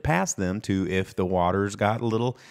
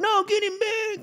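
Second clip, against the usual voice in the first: mock pleading fans. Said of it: "people were like".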